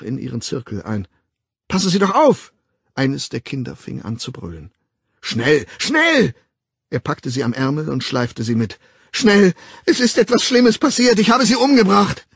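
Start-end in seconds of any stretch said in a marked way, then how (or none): 1.7-2.5 high, loud warning cry; sharp shout. A narrator's reading. Nurse shouts at the Savage to watch out, loud and high. 5.2-6.3 high, loud and frantic; urgent cry. A narrator's reading. The Savage drags the nurse along, frantic and loud. 9.1-12.3 very high, loud desperate cry; panicked cry. A narrator's reading. The Savage confesses to the nurse in a high panicked cry.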